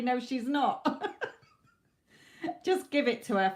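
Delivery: quoting spirit while giggling; amused laughing delivery; laughing through the words. Real, not acted. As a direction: chuckle through the quote. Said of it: "it just tickled me".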